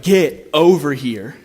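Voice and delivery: slow, loud, pleading call; fervent call. Retold or imitated. The Father calling his children, slow and loud. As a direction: call them home with open arms.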